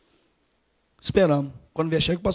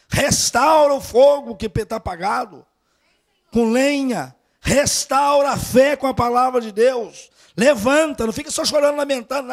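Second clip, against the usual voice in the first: raised, louder fervent preaching; preaching at full force; 4 semitones higher, 4 dB louder than usual. Acted. Preacher urging the congregation to feed on God's word, fervent and loud. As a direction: push each phrase out to the church, slowing on key lines.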